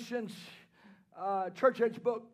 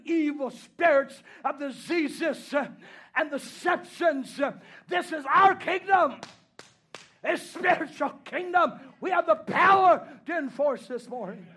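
preaching at full force; 4 semitones higher, 6 dB louder than usual. Acted. Preacher to the congregation, swelling into a shouted refrain. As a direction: build the refrain louder and higher, driving it home.

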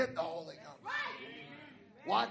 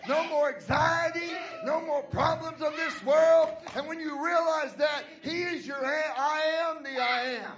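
rising, ringing preacher's cadence; preaching; 2 semitones higher, 3 dB louder than usual. Acted. Pastor stirring up the church, preaching hard. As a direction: preach it out to the congregation.

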